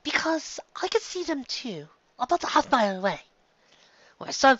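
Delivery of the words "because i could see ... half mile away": a hint of a lady's grave report; refined pony. A narrator's reading. Rarity answers.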